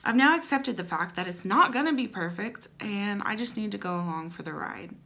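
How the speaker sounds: usual speaking voice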